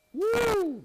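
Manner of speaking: high, drawn-out "woo"; high whoop. Real, not acted. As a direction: let out a long high whoop of wonder. Exclaims over giving child one's heart.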